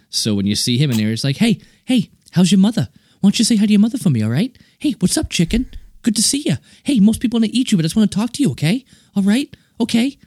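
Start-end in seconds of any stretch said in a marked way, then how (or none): 1.4-10.2 high-pitched, chummy Boston-guy patter; Mark Wahlberg impression. Mocking an actor chatting to a chicken, high chummy impression. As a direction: exaggerate an actor who always talks the same, pitched way up. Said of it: "you got Mark Wahlberg, who just never changed the way he spoke".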